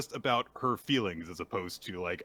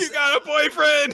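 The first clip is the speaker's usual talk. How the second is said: very high, loud mock squeal; excited squeal; 10 dB louder than usual. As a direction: shriek the big news for a friend. Friend's excited reaction acted.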